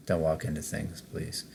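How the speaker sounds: ordinary talk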